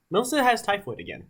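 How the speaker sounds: ordinary talk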